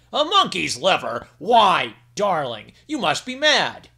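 a dragon king loudly refusing his wife; indignant king. Acted. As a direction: scold her as if she's lost her mind. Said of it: "exclaimed the king".

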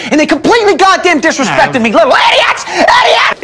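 high, loud, rising fury; outraged rant. Acted. Ranting about being disrespected, high shouting that climbs to screams. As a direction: build to screamed insults.

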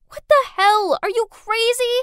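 loud, high, outraged yelling; angry shout. A narrator's reading. Narrator shoving away the ex who scared her, shouting angrily. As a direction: shout in furious disbelief. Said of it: "pushed him away and shouted".

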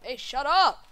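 loud angry yell; yelling shut up. Acted. Angry shout at beacons.

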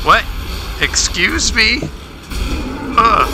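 high indignant surprise; surprised. Real, not acted. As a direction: react with shocked disbelief. Surprised reaction.

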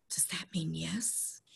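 slow, soft whispered question. Acted. Boy asking his grandfather in a slow whisper. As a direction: breathe it out in a whisper. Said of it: "asked in a whisper".

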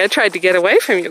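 normal talking voice

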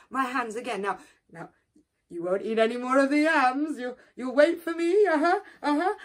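fussy apology. Acted. Turtle apologises, warns.

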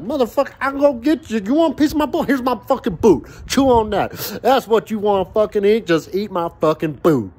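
the ruined steak talks back, high and cocky; talking steak. Retold or imitated. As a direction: squeak it out like the overcooked steak taunting you. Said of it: "It's like".

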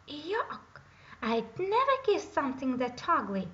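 high yuck, then a loud refusal; disgusted princess cry. A narrator's reading. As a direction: recoil from the ugly frog. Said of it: "cried the princess".

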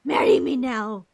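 loud, begging yell of joy; giddy shout. Real, not acted. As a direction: yell the proposal at the screen. Fangirling over game character.

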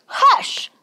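high-pitched motherly reprimand; scolding hush. A narrator's reading. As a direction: shush him as his mama. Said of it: "Mama scolded".

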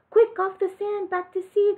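high, urgent cry to get back to sea; high-pitched urgent snail cry. Acted. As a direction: call out in a panic. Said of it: "cried the snail".

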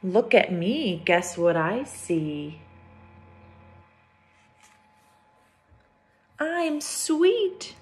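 lifted, sing-song "Look at me"; picture book read-aloud; storybook refrain. A narrator's reading. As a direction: read the refrain brightly for kids.

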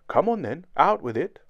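brave. A narrator's reading. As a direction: push it out boldly. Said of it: "I said bravely".

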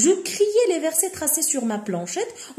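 slow, careful reading of a literary passage; reading aloud. Shown as a demonstration. Teacher reading the novel to students, slow and deliberate. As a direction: read each word slowly for the class. Teacher reads the French text.

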